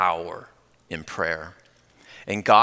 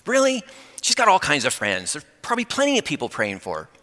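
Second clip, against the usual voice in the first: high, incredulous "Really?" easing down; protesting; 6 semitones higher than usual. Retold or imitated. Pastor arguing with the Lord about praying for her, protesting. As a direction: push back with a mild protest. Balking at the Lord's request.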